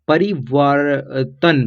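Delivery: परिवर्तन slowly in pieces, परि-वर-तन; sounding out. Shown as a demonstration. Teacher models a beginner's practice, slow sounding out. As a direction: read परिवर्तन haltingly, part by part. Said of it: "जैसे आप पढ़िए".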